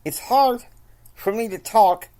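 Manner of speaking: barely holding back the anger; about to lose it. Acted. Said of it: "Kyle is about ready to lose it".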